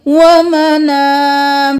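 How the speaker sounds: slow, chanted tajweed recitation; Quranic recitation. Shown as a demonstration. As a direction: chant it slowly, stretching the vowels. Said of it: "drills word slowly".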